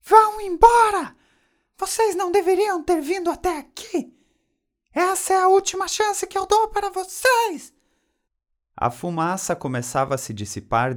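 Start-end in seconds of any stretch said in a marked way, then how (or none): 0.0-7.7 loud, very high strident shriek; irritating high-pitched angry scream; Dona Florinda screaming. A narrator's reading. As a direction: shriek it like an alarm. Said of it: "os gritos de Dona Florinda".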